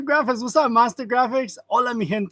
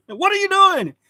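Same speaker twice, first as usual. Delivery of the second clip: much higher, louder accusing question; mock interrogation; 12 semitones higher, 8 dB louder than usual. Streamer imitating officer questioning a walker, mock interrogation. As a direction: pounce on him with an exaggerated demand.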